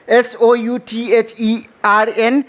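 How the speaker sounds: letters read out one by one, well apart; slow letter spelling. Shown as a demonstration. Teacher spells the word aloud for the class, slowly. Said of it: "southern पढ़ेंगे".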